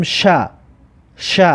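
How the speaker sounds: "ش" sounded as "sha"; shin with fatha. Shown as a demonstration. Teacher models shin with fatha for learners, "ش" as "sha". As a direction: say "ش" as a separate open "sha".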